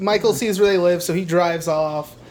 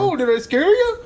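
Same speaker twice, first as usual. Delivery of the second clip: high, teasing dopey voice; goofy boyfriend voice; 10 semitones higher than usual. Retold or imitated. Boyfriend startling Lori at lunch, high and goofy. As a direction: play the prankster boyfriend lightly.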